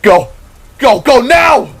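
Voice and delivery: high shouted orders to flee; screaming. Acted. Void screams at the group to run from the titan's fist. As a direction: get everyone moving now. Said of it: "Void screamed".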